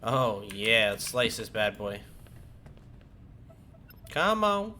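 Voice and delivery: usual speaking voice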